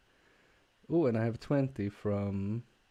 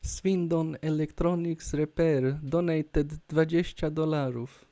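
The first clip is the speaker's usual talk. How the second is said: higher-pitched, slow Polish-accented read-out; 6 semitones higher, 3 dB louder than usual. Shown as a demonstration. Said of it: "say it in a Polish accent".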